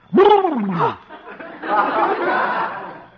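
sudden loud, high spooky outburst; mock monster scare; startling scare noise. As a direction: burst out to make her jump. He springs the scare on her.

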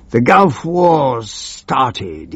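slow, drawn-out announcement in a put-on voice; triumphant cry. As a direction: proclaim it like gleeful news. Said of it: "he cried triumphantly".

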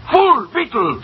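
high-pitched theatrical outburst; exasperated insults. Acted. Boss berating cashier for gambling away money, exasperated insults. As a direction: fling the insults, falling at the end.

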